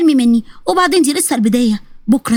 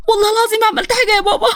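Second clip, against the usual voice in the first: sobbing plea; 9 semitones higher than usual. Acted. The daughter sobs to her father while he beats her. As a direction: plead between sobs.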